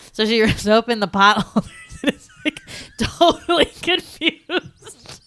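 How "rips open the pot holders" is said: ordinary delivery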